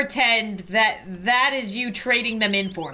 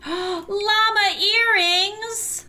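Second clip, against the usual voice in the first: gasp, then stretch out the words high and slow; thrilled squeal over cute earrings; delighted gasp; 10 semitones higher, much slower than usual. Real, not acted. Gamer spotting llama earrings, drawn-out thrilled gasp.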